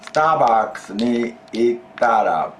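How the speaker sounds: slow, careful teacher example; slow model phrase. Shown as a demonstration. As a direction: lay each syllable out for the class. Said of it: "when you go to Starbucks".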